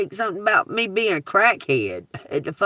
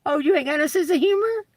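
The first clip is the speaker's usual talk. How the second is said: high, fast mocking jab; mock taunt; 10 semitones higher, faster than usual. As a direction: needle them like a smug joker.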